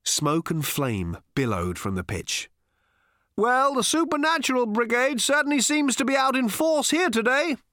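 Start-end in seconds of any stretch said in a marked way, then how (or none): 3.4-7.6 high, loud cheery broadcast voice; happy radio burble. Acted. A radio burbling to itself, cheery broadcaster voice. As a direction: chirp it like a radio announcer. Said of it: "burbled a radio happily".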